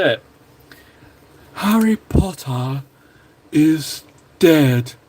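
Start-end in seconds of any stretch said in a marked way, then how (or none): 1.5-4.9 very slow, drawn-out line in a put-on voice; bad Voldemort impression. Admits he can't do it, lightly voiced gloating villain. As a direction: savour each word like a gloating dark lord. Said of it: "can't do the impression".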